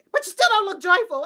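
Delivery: ordinary delivery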